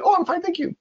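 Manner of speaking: high cheerful wrong reply; mock wrong answer. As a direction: chirp it out cheerfully. Learner gives wrong reply.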